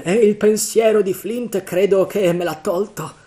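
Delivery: high, loud grumbling character; loud, uneasy complaint; grumbling pirate. A narrator's reading. Morgan blaming the thought of Flint, high loud grumble. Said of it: "borbottò Morgan".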